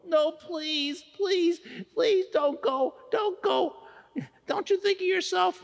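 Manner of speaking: high, begging plea; desperate pleading. Acted. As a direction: beg him not to leave. Begging friend not to go.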